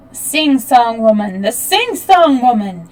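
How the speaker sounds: angry surprised cry. Acted. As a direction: shout it out in shocked anger. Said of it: "a wild cry of anger and surprise".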